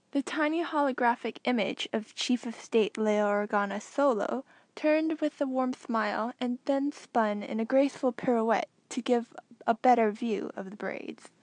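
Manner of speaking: plain reading voice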